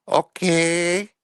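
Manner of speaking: meek little agreement; mock maid voice. Maid Gloria agreeing to coffee with the agents in falsetto.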